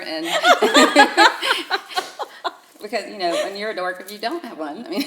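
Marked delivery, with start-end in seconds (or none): 0.3-2.8 long, loud, high laughing fit. Real, not acted. Guest laughing at her own ghost-hunter brag, loud and high. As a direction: crack up completely. Laughing about being a dork.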